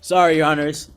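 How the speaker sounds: speaking up. Real, not acted. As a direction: project loudly into the mic. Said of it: "You gotta use your outside voice".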